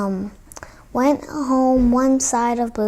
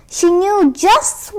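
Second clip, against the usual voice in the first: plain reading voice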